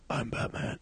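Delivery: soft, whispered superhero line; hushed, understated Batman whisper; nineties Batman. Acted. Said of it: "nineties Batmans who are like".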